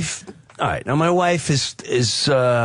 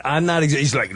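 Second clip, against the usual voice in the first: ordinary delivery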